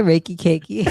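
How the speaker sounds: cracking up over a silly nickname; helpless giggles breaking into the words; laughing. Real, not acted. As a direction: say it through real laughter.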